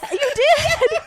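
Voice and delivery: high, loud astonished questions; shocked disbelief. Real, not acted. Friend stunned by secret Christmas village, high and loud. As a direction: gasp out your disbelief.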